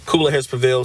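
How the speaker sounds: ordinary talk, nothing marked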